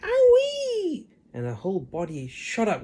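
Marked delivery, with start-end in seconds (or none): 0.0-1.1 put-on very high, loud old hag yelp; Grandma yelling. A narrator's reading. Grandma yelps as the medicine hits. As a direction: yelp as if jolted by a shock. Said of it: "Grandma yelled".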